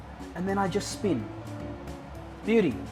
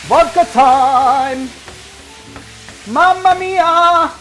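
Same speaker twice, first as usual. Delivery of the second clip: loud, slow, high exclamation; excited; 7 semitones higher, 8 dB louder, much slower than usual. Real, not acted. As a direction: announce it like a showman. Pouring vodka, exclaiming.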